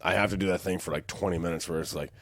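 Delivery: ordinary talk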